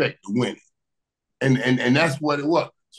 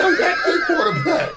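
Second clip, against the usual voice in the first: laughing shout, much higher, louder; laughing boast; 3 dB louder than usual. Retold or imitated. Bragging to a teammate, shouting through laughter.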